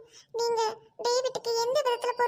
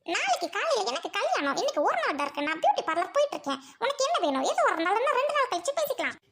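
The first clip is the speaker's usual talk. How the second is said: ordinary talk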